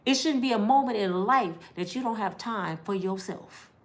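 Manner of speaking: usual speaking voice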